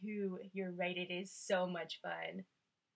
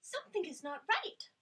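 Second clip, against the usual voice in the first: high, louder worried line; Miss Clavel alarmed; 9 semitones higher, 5 dB louder, faster than usual. Acted. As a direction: sense trouble in the night. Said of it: "Miss Clavel ... said".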